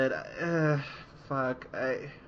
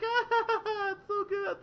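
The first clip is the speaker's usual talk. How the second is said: put-on high squealy kid voice, much higher; delighted kids; much slower than usual. Kids laughing at the pun, giddy squeal.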